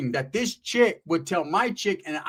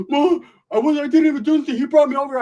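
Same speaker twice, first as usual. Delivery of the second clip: whiny excuses; 10 semitones higher, 6 dB louder than usual. Retold or imitated. Homeboy whining to his girlfriend.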